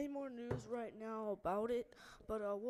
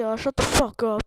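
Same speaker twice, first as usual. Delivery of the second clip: angry; 18 dB louder than usual. Real, not acted. Furious at chatbot.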